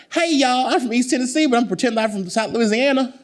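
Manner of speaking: [name] impression. Mocking [name]'s movie accent for the interviewer, high and loud. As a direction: ham up a fake Southern charm, high and bright with glee. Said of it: "there's, like, [name]".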